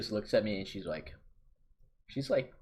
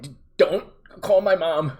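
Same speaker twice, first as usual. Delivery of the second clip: louder, higher desperate begging; panicked plea; 7 semitones higher, 12 dB louder than usual. Retold or imitated. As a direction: beg her not to call your mom. He pleads with the teacher.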